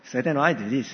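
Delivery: ordinary talk, nothing marked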